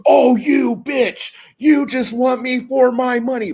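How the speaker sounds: hammed-up furious accusation; mock outraged shout. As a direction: bellow the betrayal like a cartoon victim. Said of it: "the chick goes".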